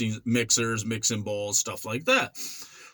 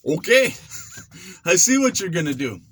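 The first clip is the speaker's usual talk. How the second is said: louder, laughing amused okay; amused scoff; 5 semitones higher, 3 dB louder than usual. Retold or imitated. Sizing up the old-school boxer, amused. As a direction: laugh him off. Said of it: "I'm like".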